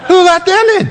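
high, loud and building; preaching at full force; rising preacher shout. Real, not acted. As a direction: fire it out to the congregation.

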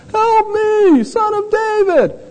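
high, thin beggar's cry for help; high-pitched plea for mercy; pleading blind beggar. Retold or imitated. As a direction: cry out like a desperate blind man. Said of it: "this guy's going".

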